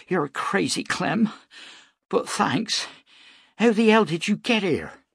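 relieved woman teasing her rescuer; Beth's voice. A narrator's reading. Woman thanking the wounded man who saved them, relieved.

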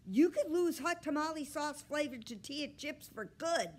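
high, loud scolding; angry threat. A narrator's reading. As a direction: threaten the dog with lost treats. Boy threatens his misbehaving dog.

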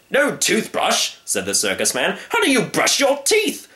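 high, slow disbelief; shocked showman. Acted. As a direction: gasp at the idea. Circus man shocked.

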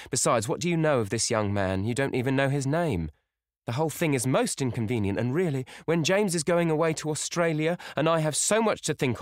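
put-on mildly reproachful older woman; scolding mother. A narrator's reading.

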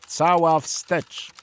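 slow, loud drawn-out command; shouted order. A narrator's reading. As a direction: bellow the order to the engine room. Captain orders full astern. (głośna, przeciągła komenda)

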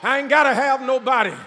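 loud, high, roaring sermon cadence; preaching at full force. Acted. Preacher rousing the congregation to be strong, shouting at full force.

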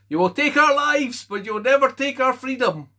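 a film hero's freedom speech, yelled at full power; Braveheart battle cry. Acted. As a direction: bellow it at the troops before the charge. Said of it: "didn't have to do a Scottish accent".

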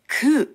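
クー as "koo", with no P at the end; silent final P. Shown as a demonstration. Teacher showing the French-derived word, クー with silent P. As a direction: say クー ending on a long oo, P left silent. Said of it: "最後のピーを発音しません".

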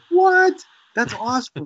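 amazed surprise. Retold or imitated. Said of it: "I was like".

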